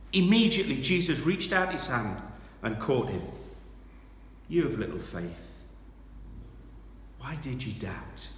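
loud, high preached scripture; dramatic sermon reading. Acted. Preacher to church, reading Peter on the water loudly. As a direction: read it out with rising fire.

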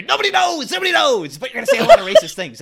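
high, loud, frantic and laughing; laughing Doc Brown impression. Doc Brown warning Ramsey while laughing. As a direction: crack up while shouting like Doc. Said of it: "another Christopher Lloyd".

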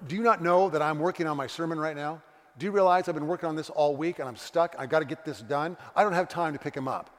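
loud, indignant rebuke; exasperated husband. Retold or imitated. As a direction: berate your wife for interrupting your work. Frustrated husband berates wife on phone.

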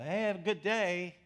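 nervous escape. Retold or imitated. Frightened man fleeing elevator.

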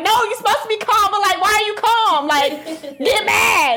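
high-pitched exasperated argument; mad. Retold or imitated. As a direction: insist in hammed-up frustration. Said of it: "So you're mad that I'm calm?".

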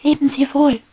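high, loud happy goodbye; cheerful farewell. Acted. Little bee Maya calls farewell to Peppy, high and cheerful. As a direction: call out goodbye full of joy.

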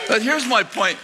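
normal talking voice

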